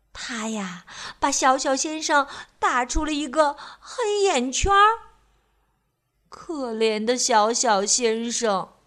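usual speaking voice

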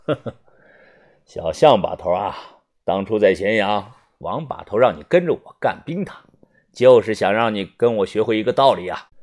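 low, measured boss voice with a short chuckle to start; calm. Acted. Tian Sanjiu lecturing the young narrator, calm and low. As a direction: drop low and speak evenly, unruffled. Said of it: "语气平静地说".

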